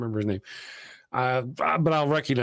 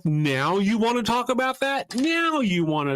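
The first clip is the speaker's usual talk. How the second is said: much higher, incredulous now you wanna talk about that; exasperated; 10 semitones higher than usual. Retold or imitated. He and his wife, watching the scene, scoff in much higher exasperation. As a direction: scoff at bad timing. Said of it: "me and the wife were like".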